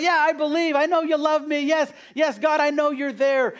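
high-pitched, eager mock assurance; easy professed belief. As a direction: imitate someone readily agreeing with God. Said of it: "Sometimes it's easy to say".

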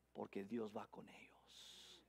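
slow, soft whisper. Acted. Preacher whispering that God goes with persecuted Christians. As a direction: whisper it like a secret promise.

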